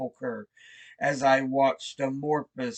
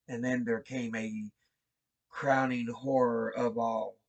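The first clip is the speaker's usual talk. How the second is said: ordinary delivery, nothing marked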